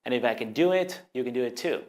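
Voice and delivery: usual speaking voice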